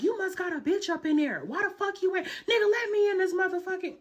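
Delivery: high, loud demanding yell; mock furious girlfriend shouting through the door; angry yell. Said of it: "she was like".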